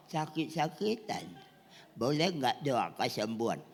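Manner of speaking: put-on soft, sickly old man's plea; elderly retired pastor. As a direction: play an ailing old minister asking timidly. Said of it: "Ada pendeta sudah emeritus". (suara pendeta tua sakit-sakitan)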